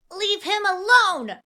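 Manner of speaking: very high, loud, drawn-out shout. A narrator's reading. Cat boy defending man from assassin, shouting. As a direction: shriek it protectively. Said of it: "the cat boy shouted".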